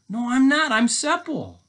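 very high, loud protest; desperate storybook captive; Seppel. Acted. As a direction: insist in a squealing little voice.

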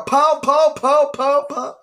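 loud, high pow pow gun sounds; hyped shouted gun noises; mock gunshots. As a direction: fire off pow pow like shots. Mimicking gunshots while hyping.

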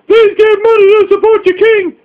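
high, whiny mock plea for money; a sneering impression of someone begging; mock beggar voice. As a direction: ham up a pathetic beggar. Mocking a begging streamer.

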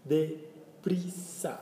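very slow, stretched Deprisa; slow teaching. Shown as a demonstration. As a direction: draw out Deprisa slowly, syllable by syllable. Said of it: "teacher models word".

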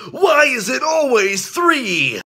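high, loud exasperated shout; anguished shout. Acted. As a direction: yell it out in despair. Repeated, louder take.

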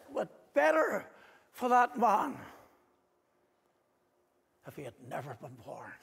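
anguished Master. Retold or imitated. Jesus speaking of the betrayer, anguished then hushed. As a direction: let the words wrench, then sink. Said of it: "the wrenching of his divine heart when he said it".

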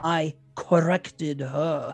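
quoting Grady. Retold or imitated. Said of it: "in the bathroom between him and Grady".

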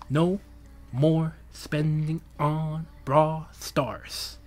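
very slow, word by word; solemn vow. Acted. Streamer swearing off game spending, slow solemn vow. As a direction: stretch out every word as a pledge.

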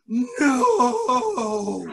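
high hammed-up no; mock disbelief. Storyteller protesting the three-year-old's age in mock shock. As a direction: cry out in playful disbelief.

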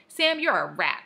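loud indignant accusation; indignant cry. A narrator's reading. Sister catching her brother's prank call, indignant cry. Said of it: "I cried".

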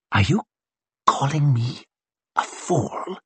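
hushed, drawn-apart words with pauses; menacing whisper. A narrator's reading. Colonel challenges a stubborn officer, menacing whisper. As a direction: drop to a whisper on the last word. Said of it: "Whispered Glocta".

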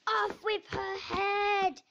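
loud, drawn-out furious order; angry roar. A narrator's reading. Very angry Queen ordering Alice's execution, roaring. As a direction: roar it slowly, high and loud. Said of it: "she roared".

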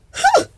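sharp high-pitched huh; mock huh. Real, not acted. Vlogger mocking the next-lifetime line with a high huh. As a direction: yelp a knowing huh.

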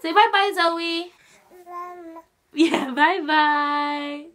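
high, loud coaxing farewell. Real, not acted. Coaxes baby to wave goodbye.

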